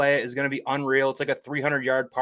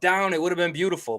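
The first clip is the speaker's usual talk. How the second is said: normal talking voice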